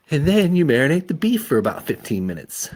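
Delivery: high, loud casual cover; mom voice. Acted. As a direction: pretend to talk recipes.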